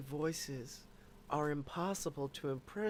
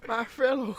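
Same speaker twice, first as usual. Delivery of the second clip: failed Obama try; 5 semitones higher, 6 dB louder than usual. Retold or imitated. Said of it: "I can't do it".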